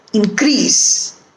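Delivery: increase as in-CREASE; weak in, strong CREASE in increase; stress on second syllable. Shown as a demonstration. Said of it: "is stressed is the second syllable".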